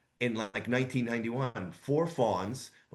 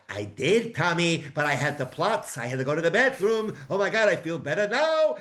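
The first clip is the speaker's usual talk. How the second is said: a hint of a fussy old man, rising higher; 4 semitones higher, 4 dB louder than usual. Coworker answers Tommy as a mock old man. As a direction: lightly play a grumbling old-timer. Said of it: "we'd be like these old men".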